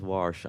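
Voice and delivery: "warsh" with an added R; mom's dialect pronunciation. Retold or imitated. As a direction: imitate mom saying wash as "warsh". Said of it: "when she says".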